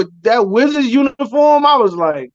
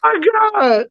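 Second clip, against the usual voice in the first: laughing; 11 semitones higher than usual. Real, not acted. Laughing hard.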